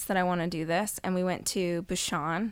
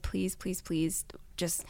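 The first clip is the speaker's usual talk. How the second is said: pleading whisper; 6 dB quieter, slower than usual. Retold or imitated. Begging husband retold, whispered.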